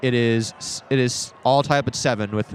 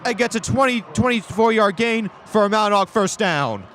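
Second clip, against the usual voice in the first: excited play call; 9 semitones higher than usual. Real, not acted. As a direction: still buzzing from the big play. Big play call continues.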